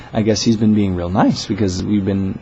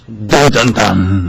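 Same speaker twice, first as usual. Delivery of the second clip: loud sung-out suspense sting; mock dramatic sting; 8 dB louder, much slower than usual. Joking cliffhanger about the manager, mock dramatic sting. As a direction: punch out three ominous mock notes.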